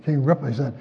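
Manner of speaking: ordinary delivery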